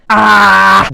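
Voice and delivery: ordinary delivery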